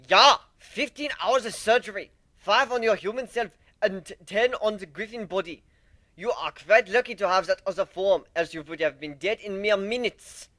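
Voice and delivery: loud, high and thrilled; eager medic nearly shouting; excited doctor. Acted. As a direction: brag about the surgery at full volume. Excited doctor describing surgery.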